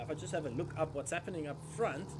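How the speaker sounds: ordinary talk, nothing marked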